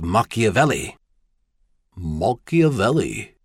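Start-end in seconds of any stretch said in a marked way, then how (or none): 2.0-3.3 slowly sounding out an unfamiliar name; puzzled repeat, slow and deliberate; slow, careful. A narrator's reading. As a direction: wrap the tongue carefully round each odd syllable. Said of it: "repeated the bizarre-sounding name slowly, wrapping his tongue carefully".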